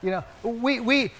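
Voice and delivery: ordinary delivery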